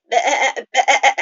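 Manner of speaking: loud, high bleating baa; sheep bleat. Shown as a demonstration. Teacher bleating loudly like a sheep for the class.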